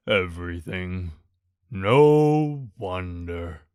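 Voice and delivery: low, slow gloomy donkey. A narrator's reading. Eeyore mournfully to Pooh, gloomy and low. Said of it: "said Eeyore gloomily".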